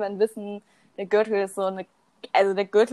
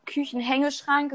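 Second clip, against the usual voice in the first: ordinary talk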